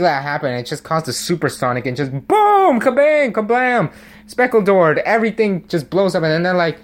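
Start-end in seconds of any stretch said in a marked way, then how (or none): 2.8-5.0 high, loud kabang blasts; explosion sound effects. Pretend. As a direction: shout out the explosions.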